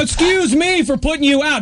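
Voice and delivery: high-pitched sarcastic apology; mock offended. Host answering back to the voicemail lady, sarcastically offended.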